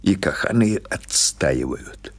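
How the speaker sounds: ordinary delivery, nothing marked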